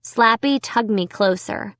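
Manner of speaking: usual speaking voice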